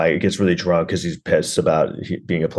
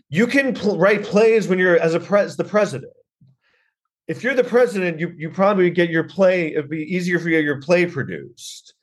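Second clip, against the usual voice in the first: high-pitched comic exasperation; exasperated argument; 10 semitones higher than usual. Acted. Comic arguing with the would-be playwright, high exasperation. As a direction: reason with him, voice pitched high in disbelief.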